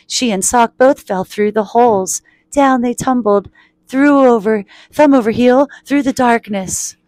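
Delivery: tumbling, building storyteller delivery; dramatic story narration. A narrator's reading. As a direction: punch each phrase of the fall.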